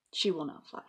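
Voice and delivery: usual speaking voice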